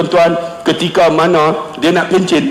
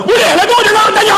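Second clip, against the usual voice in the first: very high, impatient dismissal; brusque principal; 13 semitones higher than usual. Retold or imitated. Principal dismissing the caller's question, brusque high voice. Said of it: "Dia cakap memang macam tu".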